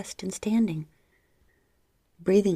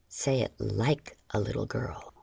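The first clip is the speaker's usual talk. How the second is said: breathed; 8 semitones lower, 4 dB quieter than usual. Acted. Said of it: "he breathed into my thigh".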